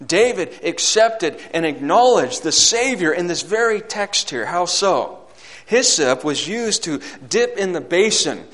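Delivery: fervent pulpit proclamation; full-force preaching. Acted. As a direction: preach it out hard, building to a loud, high peak.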